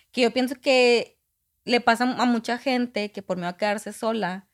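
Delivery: ordinary delivery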